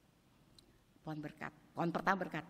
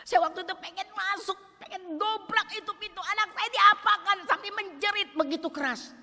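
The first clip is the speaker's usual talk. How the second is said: high, loud preaching at full force; impassioned preaching; 14 semitones higher, 16 dB louder than usual. Acted. Mother tells the church she wanted to break in, preaching loudly. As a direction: cry out to the congregation.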